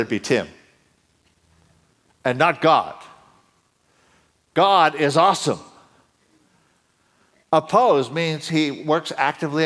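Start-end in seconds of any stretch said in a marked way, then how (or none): none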